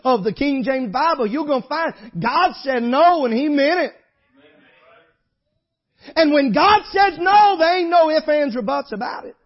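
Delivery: loud, high hellfire preaching; preaching at full force. Acted. Preacher driving the point home to the congregation, loud and high. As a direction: thunder it out from the pulpit.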